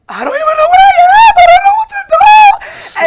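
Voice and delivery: shrieking high and fast with a laugh breaking in; dramatic panic. Retold or imitated. Coming out to mom at dawn, dramatic high laughing shriek. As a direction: overblown hysterical wail, rushing the words. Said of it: "They so dramatic".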